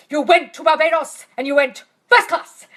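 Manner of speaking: stern teacher. Retold or imitated. Abdullah rebuking Neville's third class, stern retort. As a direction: snap it back at him.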